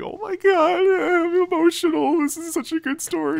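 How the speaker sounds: high, teary mock gushing; mock emotional gushing. Retelling himself gushing over the game's ending, mock emotional. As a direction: gush over the sad story in a falsetto. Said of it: "and I'm just like".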